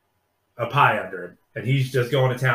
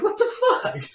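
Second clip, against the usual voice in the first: much higher, squeaky disbelief; mock disbelief; 3 dB louder than usual. Viewer reacting to the line, squeaky mock disbelief.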